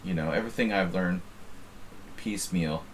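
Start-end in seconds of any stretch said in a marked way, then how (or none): none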